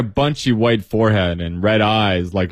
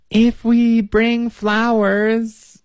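put-on hesitant girl; 12 semitones higher, much slower than usual. Marianne hesitantly starting.

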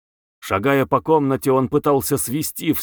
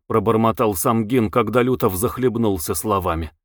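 ordinary delivery, nothing marked

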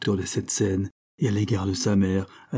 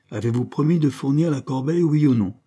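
louder on oui ou non, higher; impatient outburst; 5 semitones higher, 3 dB louder than usual. A narrator's reading. Landel presses madame Sainte-Juste, impatient demanding cry. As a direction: demand an answer, losing patience. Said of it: "s'écria Landel impatienté".

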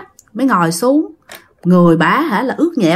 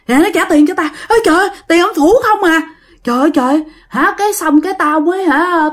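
higher-pitched, agitated account; rattled storytelling neighbour; 7 semitones higher than usual. Acted. Shaken woman telling bakers about the ghosts, agitated voice. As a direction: recount the haunting in lightly acted dismay.

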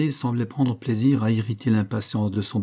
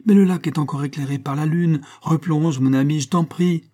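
urgent, higher and louder plea; pleading; 6 semitones higher, 5 dB louder than usual. A narrator's reading. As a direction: beg him insistently. Old man pleads for another dive. (supplication pressante, voix plus haute)

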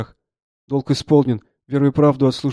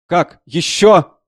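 loud, higher-pitched exclaimed questions; doctor's shout; 5 semitones higher, 9 dB louder, much slower than usual. A narrator's reading. Army doctor as more wounded are carried in, shouting. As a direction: cry out at yet another arrival. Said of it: "закричал лекарь".

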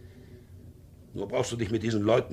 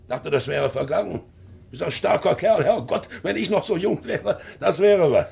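much higher and louder, laughing through the words; jovial, booming admiration; hearty laughing; 12 semitones higher, 6 dB louder than usual. Acted. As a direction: boom it out with a big laugh. Uncle hearty, envying his youth.

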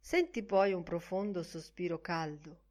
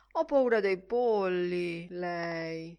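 mocking; 6 dB louder, much slower than usual. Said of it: "disse canzonatorio".

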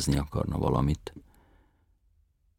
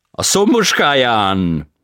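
foreign tenor voice; 9 semitones higher, 14 dB louder, much slower than usual. A narrator's reading. Tenor answers.

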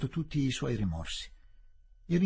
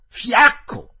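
much higher, louder repeat of fiacco; shouted insult; 12 semitones higher, 15 dB louder than usual. Acted. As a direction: hurl the word fiacco as a scream.

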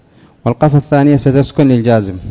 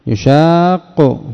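slow demo word; 3 semitones higher, much slower than usual. Shown as a demonstration. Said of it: "بقافين".

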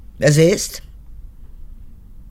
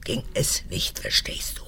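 whisper; 4 semitones lower, 7 dB quieter than usual. Acted. Guard presses point to daughter.

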